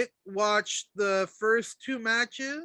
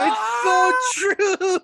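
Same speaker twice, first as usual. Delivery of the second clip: laughing; 11 semitones higher, 7 dB louder, much slower than usual. Real, not acted. Laughing hard at the meme.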